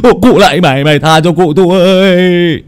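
man terrified by his dead victim's ghost, pleading loudly; terrified cry. A narrator's reading. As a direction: beg the ghost for mercy in panic. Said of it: "chỉ có thể hét lên một tiếng kinh giận".

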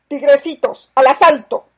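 very loud, high battle shout; battle cry. A narrator's reading. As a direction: roar the charge at the crew. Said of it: "gritó el terrible pirata". (grito de asalto, muy alto y fuerte)

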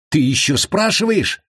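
high, outraged question; indignant anger. A narrator's reading. Prisoner rages at his calm captor, indignant. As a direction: throw the question back, pitched high.

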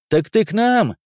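surprised high-pitched exclamation; exclaiming. A narrator's reading. Silent soldier realises the lieutenant joins his unit, exclaiming. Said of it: "воскликнул до сих пор молчавший".

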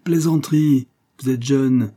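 scornful outburst. A narrator's reading. Skvortsov scolding the beggar.

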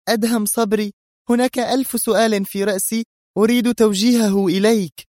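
very high, indignant character voice; exasperated woman. A narrator's reading. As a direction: demand answers in exasperation. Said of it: "هتفت منى في حنق". (حنق نسائي بصوت عالي الطبقة)

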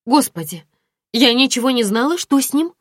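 high, loud alarmed questioning. A narrator's reading. Friend hears Dima was in hospital, alarmed.

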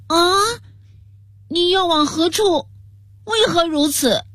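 high, alarmed startled question; shocked. A narrator's reading. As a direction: gasp it out in alarm. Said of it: "皇太子大惊失色道". (大惊失色地惊问)

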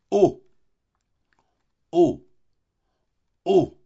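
loud O with rounded lips, drawn out. Shown as a demonstration. Teacher demonstrating O, loud and rounded. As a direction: boom out a rounded O. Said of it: "La voix O se forme en rouvrant les mâchoires".

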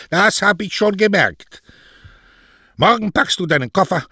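high, loud, angry retort; exasperated mother. Acted. Mother orders her daughter to pack, loud and cross. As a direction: snap the order at your daughter.

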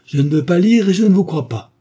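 loud, high, vehement outburst; angry refusal. A narrator's reading. Young duke refusing to read his mother's confession, angry outburst. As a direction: throw the denial back at full voice.

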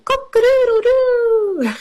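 very high, long crow; playful high cock-a-doodle-doo; rooster crow. Shown as a demonstration. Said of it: "what noise does the chicken make".

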